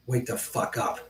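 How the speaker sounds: harsh angry whisper. Real, not acted. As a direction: hiss it under your breath. Ranting at Q anon viewers.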